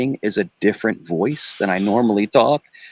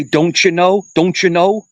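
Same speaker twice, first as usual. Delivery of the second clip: a higher, sing-song Upper Midwest "Don't you know"; mock Minnesota accent; 7 semitones higher than usual. Host teasing a caller about Midwest speech, mock Minnesota accent. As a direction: ham up the Fargo-style accent. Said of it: "when they say".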